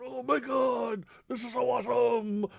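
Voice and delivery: put-on kids gasping in wonder; thrilled kids. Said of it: "we were going".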